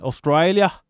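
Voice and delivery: exaggerated Australian accent on the country's name; mocking imitation of an Australian accent; mock Aussie accent. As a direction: say it like a caricature Aussie. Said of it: "that's an Aussie".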